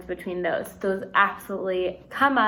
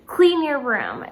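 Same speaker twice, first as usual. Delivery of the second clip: firm, slow, louder order; command; 4 semitones higher, 6 dB louder, slower than usual. Shown as a demonstration. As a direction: say it like a parent giving an order. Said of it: "That is someone giving a command".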